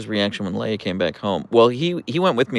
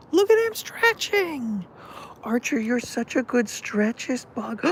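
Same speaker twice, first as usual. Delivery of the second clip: much higher, slow pet-talk coo. Real, not acted. Baby talk to stretching Archer.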